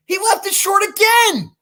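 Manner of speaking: exasperated shout. Retold or imitated. Retelling a fumbled golf shot.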